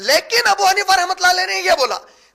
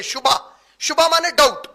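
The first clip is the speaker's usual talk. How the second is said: ordinary delivery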